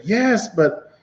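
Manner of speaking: a lightly voiced baffled neighbor; neighbor's bewildered account. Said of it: "he's like".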